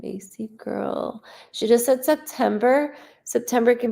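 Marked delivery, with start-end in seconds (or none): 0.0-1.2 soft whispered name. Real, not acted. Calling softly to the spirit of the girl, whispered. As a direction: breathe the name out. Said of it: "whispered name".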